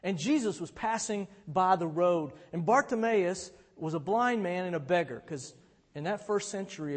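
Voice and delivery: usual speaking voice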